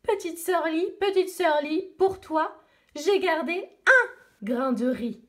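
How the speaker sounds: light friendly duck voice. Acted. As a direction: offer it gently like a helpful duck. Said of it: "le canard sauvage passe au-dessus d'elle".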